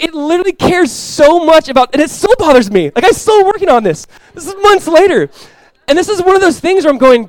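high, loud preaching cry; passionate preaching. Acted. Preacher confessing to his church, high and loud. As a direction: pour out the confession at full force.

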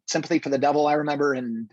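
ordinary talk

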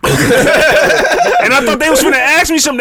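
high, loud laugh-filled telling. Real, not acted. Telling friends the story, laughing through it.

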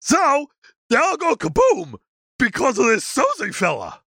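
high dopey creature voice; goofy high-pitched monster; Sasquatch voice. Acted. Sasquatch character voice.

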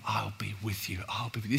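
tender whispered promise; soft then whispered. Shown as a demonstration.

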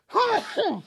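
sudden loud high sneeze; sneezing. Real, not acted. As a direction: let out a sharp sneeze. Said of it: "Excuse my sneeze".

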